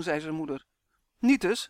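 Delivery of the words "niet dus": high, loud contradiction; called-out denial. A narrator's reading. Son contradicting his mother in playful squabble, calling out. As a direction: fire back, raised in pitch and volume. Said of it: "riep Roy".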